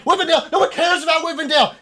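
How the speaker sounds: high, loud, quick dismissal; frantic mad rant; insane elf. Acted. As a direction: scoff in a crazed shout. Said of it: "fucking insane elf".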